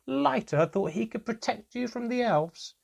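very high-pitched mocking disbelief; derisive hilarity. A narrator's reading. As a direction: ridicule it as if barely holding back laughter. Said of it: "He roared again with laughter".

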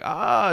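high yelp of pain, retold; imitating her sudden cry of pain; mock pain scream. Woman on the street suddenly crying out, mild mock scream. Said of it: "she's, like, screaming in pain".

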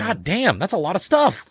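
high-pitched comic astonishment; mock amazement. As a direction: marvel at the sheer load. Acting out amazement at the load.

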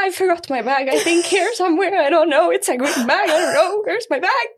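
mock her own helpless panic; flustered. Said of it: "jag bara". (förvirrad och ängslig)

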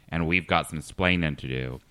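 usual speaking voice

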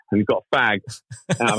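ordinary talk, nothing marked

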